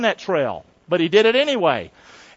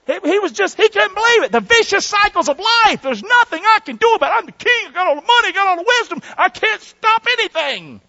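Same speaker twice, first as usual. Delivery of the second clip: frantic king; 10 semitones higher than usual. Acted. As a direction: cry out that nothing can be stopped. Preacher voices frantic king.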